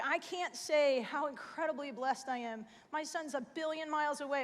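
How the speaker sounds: ordinary delivery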